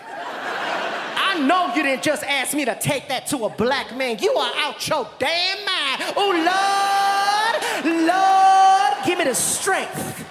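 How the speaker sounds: indignant rant swelling into a wail to the Lord; outraged woman. Acted. Woman refusing to carry the basket, outraged.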